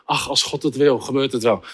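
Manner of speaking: low, soft, resigned shrug; passive resignation. Acted. Seriously ill girl leaving healing to God, passively resigned. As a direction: let it drop as if nothing matters. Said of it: "Met de houding".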